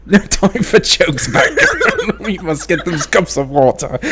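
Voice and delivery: British rallying cry, near laughter; mock British accent. Host redoing the Batgirl line, mock British accent. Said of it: "We're all gonna be British".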